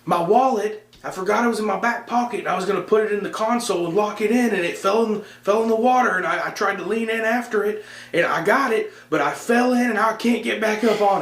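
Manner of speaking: high, hurried dad explaining the mishap; dad explaining from the water, pitched high and flustered. Retold or imitated. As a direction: blurt it out high and breathless. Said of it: "He goes".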